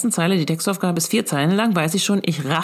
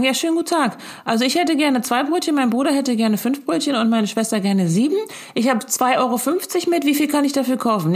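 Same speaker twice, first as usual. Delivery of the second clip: higher, prim and overly polite at the counter; mock polite customer; 7 semitones higher than usual. Mother mocks ordering rolls at the bakery, in a higher, prim polite voice. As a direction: play a stiff customer reciting a word problem. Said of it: "stehen und sagen".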